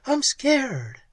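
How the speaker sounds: high, frightened whimper. A narrator's reading. Pat to Ham, scared whimper. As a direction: whimper it to him. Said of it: "She whimpered".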